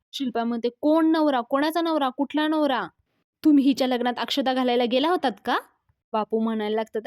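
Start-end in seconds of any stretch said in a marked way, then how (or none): 0.8-5.6 higher, louder rapid-fire questions; acted outraged retort, pitched up; indignant challenge. Angrily challenging the husband claim.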